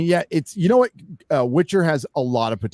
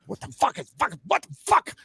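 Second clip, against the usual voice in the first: high-pitched sputtering replay of shock; squeaky mock-incredulous repeats; retold disbelief. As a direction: act out baffled outrage, leaping way up in pitch. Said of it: "I was like".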